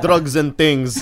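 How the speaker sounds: drawn-out, loud London slang; hammed-up London slang accent, slow; mock London accent. Mimicking London slang, others laughing.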